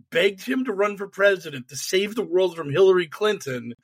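ordinary talk, nothing marked

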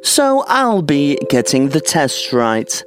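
high, slow know-it-all schoolgirl; smug and prim, drawn out; Hermione impression. Hermione gloating to Harry about the book, high and slow. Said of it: "Hermione, of course, who says".

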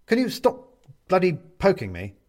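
Benedict, jabbed with a pin, complains to the clown; irritated complaint. Acted. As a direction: snap back at the pest poking you. Prodded captive snaps at clown.

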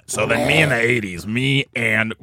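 low, slow, lazy rocker drone; imitating Jim Florentine. Retelling Jim Florentine's eighties story, low slow impression. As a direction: drag it out like a burnt-out metal guy. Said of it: "Jim Florentine's like".

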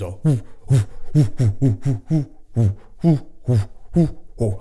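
short, airy hoots in a steady puffing rhythm; huffing flute imitation. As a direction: mimic an old player huffing into a giant flute. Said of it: "he fucking huffs it ... gives it a good huff".

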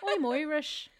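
hammed-up, badly faked Irish accent; fake Irish accent. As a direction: tease with a silly Irish "oy" sound. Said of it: "do Irish".

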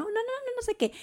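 imitating her, high and soft; host declining. (imitando a Ashley que se niega, aguda y suave)

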